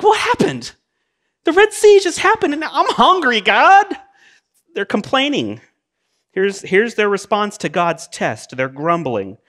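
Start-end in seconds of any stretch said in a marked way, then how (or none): 0.0-3.9 high, loud whining to God; whiny complaint. Acted. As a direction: whine like a spoiled child. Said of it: "It's like".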